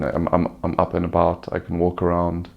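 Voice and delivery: ordinary talk, nothing marked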